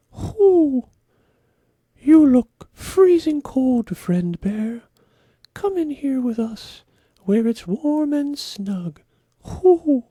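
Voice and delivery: high, loud owl voice with hoots; hooting owl. Acted. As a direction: hoot and call out like a big owl. Owl invites the bear.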